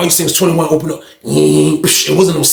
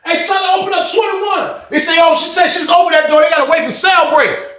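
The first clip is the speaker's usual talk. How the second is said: higher raised call; 8 semitones higher than usual. Retold or imitated. Tall dude shouts to the booth, calling out. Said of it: "dude was like".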